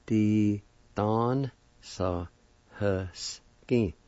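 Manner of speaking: The don sa husky very slow, each syllable separate. Shown as a demonstration. Teacher repeating pickpocket, The don sa husky slowly by syllable. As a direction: stretch out The don sa husky with gaps between syllables. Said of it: "like this".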